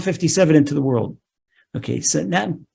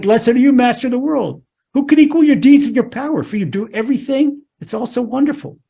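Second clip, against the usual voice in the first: raised, exultant retold blessing; overjoyed praise; 6 semitones higher than usual. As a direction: act out David praising his maker with joy. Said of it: "King David was so overjoyed".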